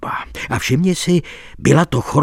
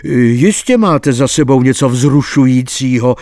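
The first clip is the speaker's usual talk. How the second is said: louder eager exclamation; exclaimed; 6 dB louder than usual. A narrator's reading. Friends greet the flustered actuary with an eager exclamation. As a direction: call it out together, curious. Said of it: "zvolali jsme".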